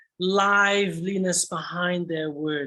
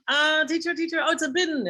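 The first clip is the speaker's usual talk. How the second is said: eager pupil; 9 semitones higher than usual. Retold or imitated. Teacher voices pupil answering.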